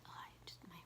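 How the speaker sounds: muttered whisper to herself; whispering about her own hair; whispered aside. Real, not acted. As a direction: whisper it to yourself. Whispered self-remark about hair.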